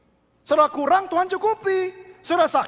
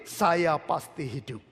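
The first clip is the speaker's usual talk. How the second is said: slow, softer, lower confession of life; calm confident faith; 9 semitones lower, 3 dB quieter, much slower than usual. Shown as a demonstration. Preacher modelling the right words for the congregation, calm and slow. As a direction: say "Saya pasti hidup" slowly and calmly, lower and softer. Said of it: "bilang begini".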